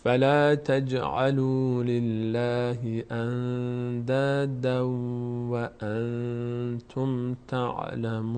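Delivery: slow, measured tajweed chanting; unhurried, sustained recitation; Quran recitation. Shown as a demonstration. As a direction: hold the long vowels and nasals on an even note. Teacher recites verse.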